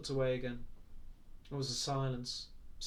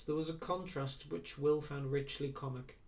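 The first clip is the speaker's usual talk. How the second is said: ordinary delivery, nothing marked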